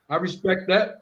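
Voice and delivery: ordinary delivery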